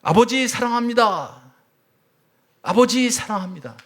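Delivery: loud, slow cry of love to a father; shouted declaration. Retold or imitated. Son shouting love to his late father, loud slow cry. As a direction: call it out loud and slow, with everything you have. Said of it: "이렇게 외칩니다".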